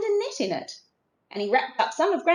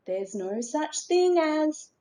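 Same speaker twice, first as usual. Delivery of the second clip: sister's refrain; 7 semitones higher than usual. A narrator's reading. Sister starts her refrain again.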